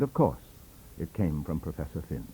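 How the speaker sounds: ordinary delivery, nothing marked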